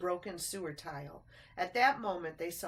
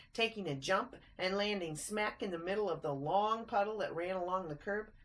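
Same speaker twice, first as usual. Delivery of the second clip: plain reading voice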